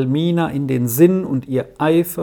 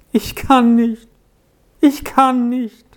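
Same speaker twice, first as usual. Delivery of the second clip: higher, tearful repeated plea; sobbing child; 8 semitones higher, 4 dB louder than usual. A narrator's reading. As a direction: sob it out, pitched up like a child. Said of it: "schluchzte Peppino hervor". (schluchzend, hoch, wiederholt)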